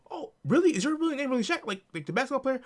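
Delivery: high, bright-eyed mock disbelief.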